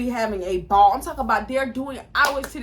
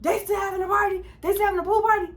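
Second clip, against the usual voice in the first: higher, eager over the party news; sudden excitement; 8 semitones higher than usual. Retold or imitated. Heartbroken girl suddenly excited about the pool party. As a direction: perk right up at the word party. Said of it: "I'm like".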